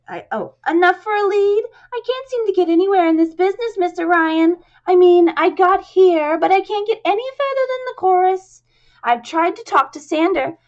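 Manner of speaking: high, loud, squeaky pleading; squeaky chorus girl, accent dropped. Shown as a demonstration. Chorus girl complaining to Ryan, high and squeaky. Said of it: "get rid of her accent".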